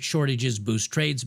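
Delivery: normal talking voice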